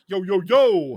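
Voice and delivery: loud, high hail in a robot's voice; Alpha Six voice. Acted. Host recalls the robot's old voice, loud high Alpha Six impression. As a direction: bellow it like a brash robot sidekick. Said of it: "Could we get his old voice back?".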